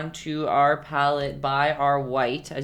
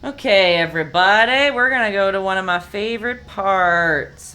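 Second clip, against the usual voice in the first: put-on louder, higher Texan accent calling out to the class; sudden Texan twang; 5 semitones higher, 8 dB louder than usual. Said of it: "I just turned Texan all of a sudden".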